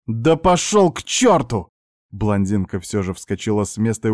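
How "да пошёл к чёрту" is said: high, drawn-out furious dismissal; angry curse. A narrator's reading. Blonde jumps up at her uncle, furious. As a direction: curse him off, pitch raised.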